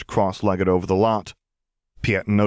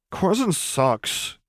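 put-on grousing teen; 10 semitones higher, much slower than usual. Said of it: "Anakin groused".

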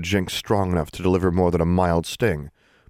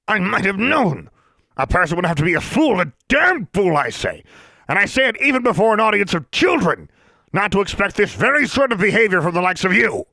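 bellowing Mister Brown; 13 semitones higher, 5 dB louder than usual. A narrator's reading. Outraged official bellows at the students. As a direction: roar it across the room like an outraged official.

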